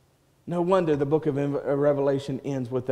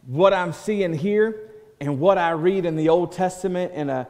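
normal talking voice

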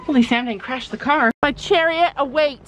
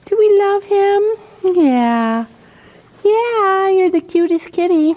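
high, louder cooing; 8 semitones higher, 5 dB louder, slower than usual. Real, not acted. Vlogger coos baby talk at the barn cat. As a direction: coo at the kitty.

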